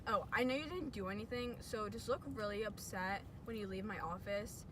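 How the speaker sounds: ordinary talk, nothing marked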